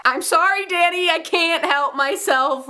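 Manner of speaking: high, drawn-out mock plea; whiny apology. Fan apologising to Danny for following Peter, whiny plea.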